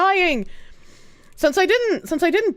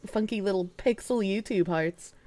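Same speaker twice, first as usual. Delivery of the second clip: normal talking voice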